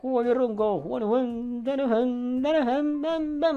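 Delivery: high stretched announcer call; sing-song announcer voice. Acted. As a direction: call out the name like a ring announcer. Presenta a un seguidor, voz cantada. (voz de presentador cantada)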